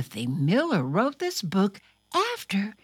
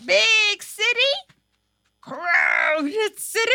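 higher, louder dramatic reading; storybook reading; 12 semitones higher, 3 dB louder than usual. Acted. Host reading the book aloud to children, dramatic. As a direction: call it out like a big city.

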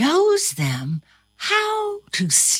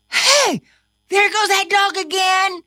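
calling out; 11 semitones higher, 3 dB louder, faster than usual. A narrator's reading. Character calls out.

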